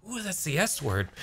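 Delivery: high, soft gasping prude; scandalized voice. Retold or imitated. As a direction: act shocked at the word. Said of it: "the guy's like".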